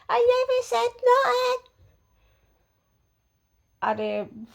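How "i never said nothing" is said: very high put-on voice, playing innocent; mock protesting denial. Neighbour denying it to Peter, high mocking imitation. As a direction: mimic her denial way up high.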